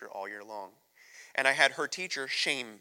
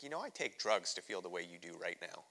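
dead serious; 5 semitones lower, 7 dB quieter than usual. Retold or imitated. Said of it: "looks at me dead serious".

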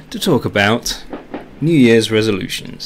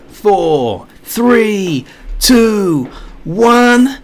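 rising, louder excited countdown; new year countdown crowd; 8 semitones higher, 4 dB louder, slower than usual. Real, not acted. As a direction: count down to midnight with growing excitement.

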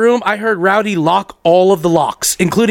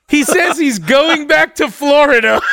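slowed, higher, breaking into laughter; can't keep a straight face on this line; retelling the absurd news while cracking up; laughing through it; 6 semitones higher than usual. Real, not acted. Laughing while quoting Courtney.

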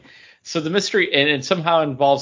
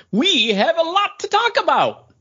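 dramatic reading. Acted. Cohost performing a comic panel as a dramatic reading. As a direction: ham it up like a cartoon character. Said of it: "our dramatic reading".

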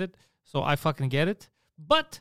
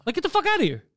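much higher-pitched, indignant dismissal; exasperated outburst; 13 semitones higher, faster than usual. Retold or imitated. Passenger retelling his fed-up dismissal of a seatmate, exasperated. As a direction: replay telling a seatmate off, pitch shooting up. Retold quarrel over plane seat.

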